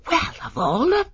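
put-on young woman outraged by a stranger's advice; affronted exclamation. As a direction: splutter at the cheek of it. Lily affronted by warning.

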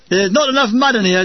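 a touch of a chuckle; chuckling. A narrator's reading. Fuller joking to tentmates about mud, mildly chuckling. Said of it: "chuckled Fuller".

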